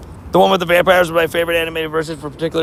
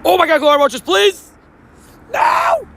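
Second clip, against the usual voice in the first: excited shriek; 11 semitones higher, 4 dB louder than usual. Real, not acted. Sees glowing roaches in game.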